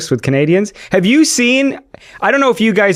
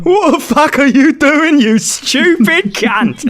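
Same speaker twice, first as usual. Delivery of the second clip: higher Cockney geezer voice, slowing at the end; mock Cockney; 11 semitones higher, slower than usual. Gamer repeating his Cockney voice for friends, hammed-up. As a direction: sneer it like a Cockney lad. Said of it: "That's quite a good accent".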